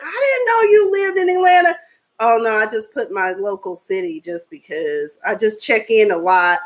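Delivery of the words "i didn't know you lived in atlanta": high, loud surprised outcry; surprised exclaim. Retold or imitated. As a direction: squeal it like shocked news. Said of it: "people ... have kind of been like".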